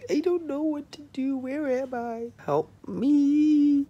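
high, playful cry for help; hammed-up helpless little voice; mock damsel in distress. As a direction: squeak out a fake plea for rescue. Mock lost, distressed jokey voice.

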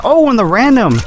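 sudden high excited yell; excited shout. Real, not acted. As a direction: burst out as the move lands. Surprise fatal blow in match.

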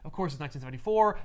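usual speaking voice

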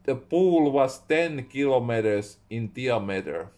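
ordinary delivery

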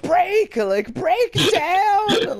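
high, loud, stretched yell at a wall; mock yell at wall. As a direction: beg the wall to break, hammed-up. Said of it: "I'm just like".

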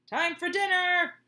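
loud, high call across the yard. Acted. Dad on the porch calling the kids, loud and high. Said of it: "he called".

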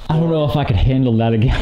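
scared. Real, not acted. Frightened after ghost push.

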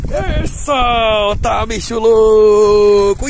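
slow loud yell at a wild dog; loud drawn-out call to the dog; startled yell. Real, not acted. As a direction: shout to let go. Dog running at him.

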